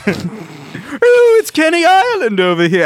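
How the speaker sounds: high, loud mock teasing call. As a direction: tease a friend in a high voice. Teasing Steven with a nickname.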